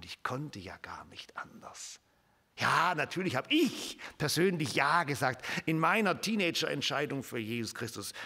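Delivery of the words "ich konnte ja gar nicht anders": a soft awed confession; hushed whisper. Acted. Preacher whispering to the congregation, awed. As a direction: whisper it, almost to yourself.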